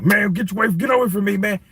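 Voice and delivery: lightly voiced flustered protest; mock protest. Skit, Errol Spence pushing away.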